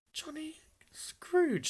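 slow high whisper in awe; awed whisper. Acted. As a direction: breathe the name in wonder.

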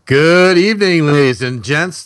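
drawn-out showman welcome; radio host greeting. Acted.

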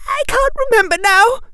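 put-on high, fast, distressed girl's voice; desperate, high-pitched outburst, voice breaking; voice cracking. A narrator's reading. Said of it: "her voice cracking".

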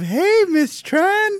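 high, very slow cartoon squeak; squeaky mock voice. Recalling a school throwback to friends, very slow high squeaky voice. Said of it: "that kinda reminds me of".

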